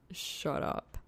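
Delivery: embarrassed mutter. Acted.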